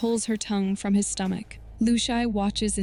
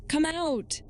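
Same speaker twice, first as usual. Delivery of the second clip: high-pitched call without extra volume; narrated scream, raised in pitch only; restrained scream; 8 semitones higher than usual. A narrator's reading. Lu Shai calls the ghost woman out, restrained scream. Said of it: "Lu Shai screams".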